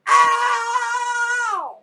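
sudden high yelp of pain; retold yelp as Hunter gets hurt; cry of pain. Hunter yelps in pain, mock cry. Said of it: "cries Hunter".

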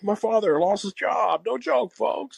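milk the no joke, folks line; Carvey's Biden. Retold or imitated. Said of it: "Dana Carvey do his impression".

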